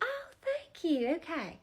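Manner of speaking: very high, sugary grandma greeting; high doting old-lady voice; sweet old lady voice. Retold or imitated. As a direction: coo at him like a doting granny teller. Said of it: "And she's like".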